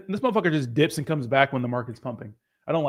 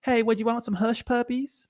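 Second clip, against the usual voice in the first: higher-pitched Southern girl impression; Southern accent; 7 semitones higher, 3 dB quieter than usual. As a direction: imitate a sweet-talking Southern girl. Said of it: "fell in love with the Southern accent".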